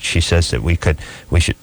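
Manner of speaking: normal talking voice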